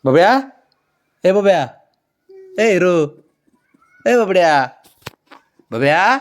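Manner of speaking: high, loud repeated name calls; calling pet. Real, not acted. Owner calling her dog by pet names, high. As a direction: call the dog sweetly over and over.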